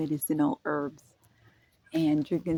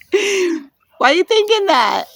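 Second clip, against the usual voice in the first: self-scolding; 12 semitones higher, 9 dB louder, slower than usual. Retold or imitated. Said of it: "a tad bit shameful".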